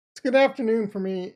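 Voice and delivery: jokey high-pitched greeting; high put-on voice. Shown as a demonstration. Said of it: "why did I do that?".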